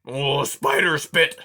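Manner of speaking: swearing out loud. A narrator's reading. Aerith cursing the storm out loud, slow and angry. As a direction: spit out the curse. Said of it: "he swore out loud".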